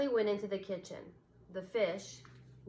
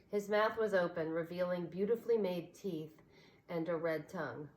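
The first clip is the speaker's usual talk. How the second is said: usual speaking voice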